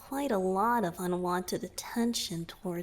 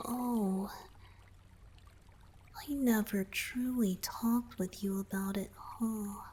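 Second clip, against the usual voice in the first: soft breathy whisper close to the ear; 5 dB quieter than usual. Acted. Merman confiding to his savior in a whisper. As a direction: whisper it like a confession.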